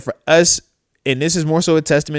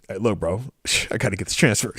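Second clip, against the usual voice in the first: lower, softer, a quick sighing excuse; mumbled mock excuse; 7 semitones lower, 8 dB quieter, faster than usual. The friend coach brushing him off about a transfer, low and hurried. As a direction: sigh and rush the busy-coach excuse. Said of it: "I'm like".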